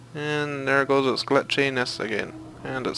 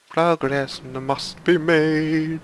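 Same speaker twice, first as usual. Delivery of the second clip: drawn-out, higher proclamation; slow declaration; 4 semitones higher, much slower than usual. Pretend. Player declaring that progress must be made, slow declaration. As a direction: stretch the words out like a proclamation.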